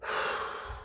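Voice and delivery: long audible exhale; heavy puff of air; big blown breath. A narrator's reading. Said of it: "he blew big breaths".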